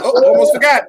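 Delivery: high, loud cartoon old man; Quagmire voice. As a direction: squeak it out like a cartoon lech. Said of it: "And he's like".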